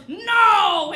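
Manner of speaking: loud, high retold no; angry yell. The stabber refusing the gift card with a loud yell. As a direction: bark a flat refusal. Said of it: "he goes".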